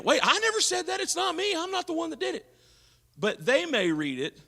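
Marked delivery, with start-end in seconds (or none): 0.0-2.5 high defensive "I never said that"; defensive protest. Retold or imitated. Sender pleading innocence to the reader, high and defensive. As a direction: squeak out a hurt denial. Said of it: "You might have been saying".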